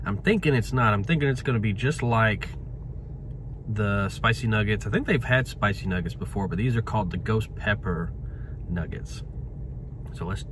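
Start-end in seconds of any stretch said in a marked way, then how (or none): none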